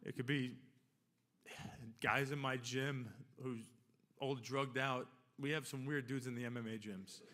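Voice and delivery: ordinary talk, nothing marked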